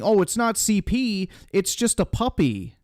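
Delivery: high, mocking excuse-maker voice; mocking whiny voice. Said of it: "That's like saying".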